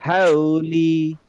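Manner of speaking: "হাউলি" very slow, glide held; light lin stretch. Shown as a demonstration. Teacher reading for students to follow, "হাউলি" stretched. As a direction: draw out both syllables of "হাউলি". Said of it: "একটু হালকা লিন হয়ে যেন উচ্চারণ করি".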